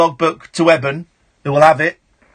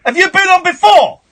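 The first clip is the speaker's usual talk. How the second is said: high, insistent demand; indignant, raised-voice questioning; exasperated challenge; 12 semitones higher, 4 dB louder than usual. Real, not acted. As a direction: press the caller for an answer. Host challenges repeat caller.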